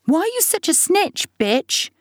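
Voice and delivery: high, mocking boy's jeer; taunting young boy. A narrator's reading. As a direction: needle your sister. Sibling bickering at supper.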